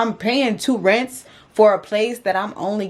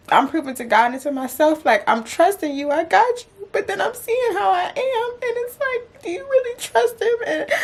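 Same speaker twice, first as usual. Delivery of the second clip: crying; 11 semitones higher than usual. Real, not acted. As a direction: voice climbing into real sobs. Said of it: "I don't know why it makes me cry".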